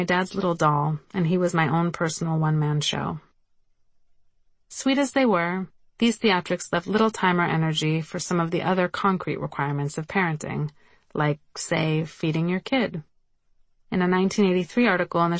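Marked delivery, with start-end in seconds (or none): none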